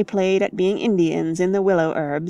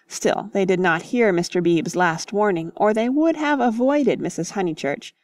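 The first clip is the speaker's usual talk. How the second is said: usual speaking voice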